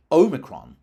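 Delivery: Omicron as OH-mi-kron, long first vowel; variant H, long O. Shown as a demonstration. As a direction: punch the long OH that opens Omicron. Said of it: "So variant H".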